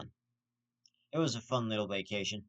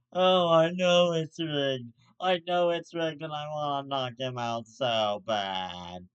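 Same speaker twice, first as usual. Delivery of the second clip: mock tearful Mike Tyson voice, slow and much louder; mock crying Mike Tyson; 6 dB louder, slower than usual. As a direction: ham up a sobbing Tyson complaining it's rigged. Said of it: "Mike Tyson was probably crying 'cause he's like".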